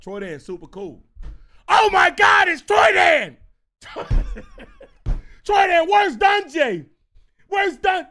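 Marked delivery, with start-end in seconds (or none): 1.7-3.4 high, full-volume fan scream; mock excited yelling; starstruck kid shout. 3.8-5.4 high, loud burst of laughter; helpless high laughing; real laugh. Real, not acted. Cracking up at own impression. 5.4-8.1 high, loud fan yelling questions; imitating an overexcited kid; excited kid shout.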